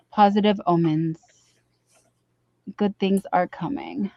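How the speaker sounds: ordinary talk, nothing marked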